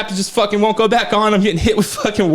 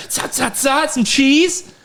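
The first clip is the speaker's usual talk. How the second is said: normal talking voice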